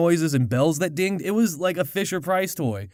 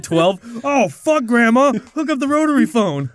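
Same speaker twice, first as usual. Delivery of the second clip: higher, louder frantic pleading; excited kid; 5 semitones higher, 5 dB louder than usual. Retold or imitated. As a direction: beg Grandma, all worked up. Excited kid voiced, shouting.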